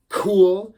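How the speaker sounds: „cool“ with a loud, high, punched k; stressed hard k. Shown as a demonstration. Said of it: "think of it like a C H".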